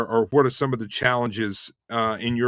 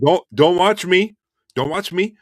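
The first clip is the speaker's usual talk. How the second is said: raised, insistent warning to young imitators; emphatic retold advice; 6 semitones higher, 7 dB louder than usual. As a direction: push it higher and louder, like a mentor insisting.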